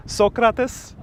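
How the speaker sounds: Socrates high and rising, Greek-style; testing pronunciation. Shown as a demonstration. Trying Greek names on viewers, excited questioning. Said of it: "I can now say all the Greek words".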